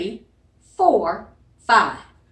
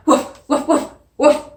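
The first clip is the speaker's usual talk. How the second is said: short barks; playful puppy barks; dog bark; 3 semitones higher, 3 dB louder than usual. Shown as a demonstration. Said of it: "let me hear you bark".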